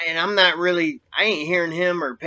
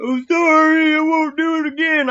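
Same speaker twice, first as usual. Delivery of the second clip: very high, loud wail of an imitation; mock yelling; 14 semitones higher, 7 dB louder than usual. Prisoner in the yard mimicking the beaten man's screams, mock yelling. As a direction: holler a mimicked plea at the top of your voice. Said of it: "he starts yelling at you".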